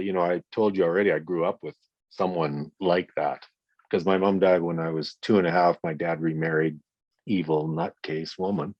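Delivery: usual speaking voice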